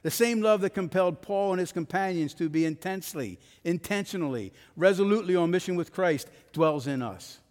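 loud, driving sermon build; forceful preaching. Acted.

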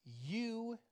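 "you" stretched long and heavy; emphatic YOU. Shown as a demonstration. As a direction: lean hard and slow on "you". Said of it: "meaning you with emphasis".

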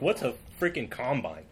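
usual speaking voice

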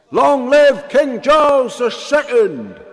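loud, high, slow toast; drunken shout. Acted. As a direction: bellow a drunken cheer. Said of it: "shouted the King's men".

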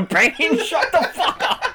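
hammy villain yelling, laughing; Joker voice. Pretend. Joker yells at Bane.

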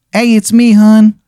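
high-pitched, hammed-up Maryland caller; terrible Maryland accent. Unseen visitor at the cabin door, bad Maryland accent. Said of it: "the worst Maryland accent you've ever heard".

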